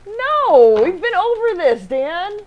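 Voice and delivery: high, loud, mock-exasperated no; teasing exasperation at a co-host. Real, not acted. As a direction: scold him like a patient teacher.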